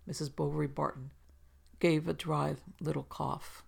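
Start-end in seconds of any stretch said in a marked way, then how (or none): none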